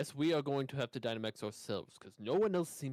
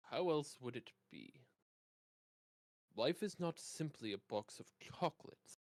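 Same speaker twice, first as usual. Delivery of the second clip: put-on British accent. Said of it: "What are you talking about? Have you lost your mind".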